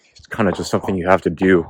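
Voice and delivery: ordinary talk, nothing marked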